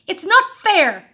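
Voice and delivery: loud, high, slow howl; a boy howling loud and high as he is sent away; howled complaint. A narrator's reading. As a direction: wail the unfairness at the top of your voice. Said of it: "howled Henry".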